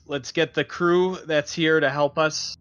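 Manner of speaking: ordinary delivery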